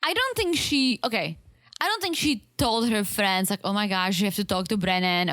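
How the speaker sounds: ordinary talk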